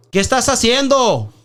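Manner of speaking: loud, high shout in Spanish; mock angry neighbor shout; neighbor yelling. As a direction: yell it down from the roof.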